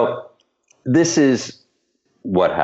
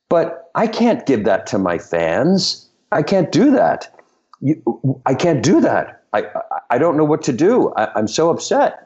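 mildly agitated, higher-pitched pleading; nervously freaking out; 6 semitones higher than usual. Retold or imitated. Choreographer retelling the star's plea over the video, nervously upset. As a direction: imitate a flustered star, stammering and pleading. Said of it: "He was freaking out nervously".

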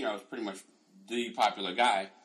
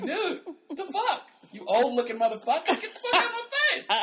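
loud, high comic indignation with laughter; hammed-up telling-off of an old friend; mock outrage; 8 dB louder than usual. Acting outraged comeback to classmate.

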